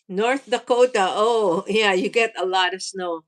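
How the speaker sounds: ordinary talk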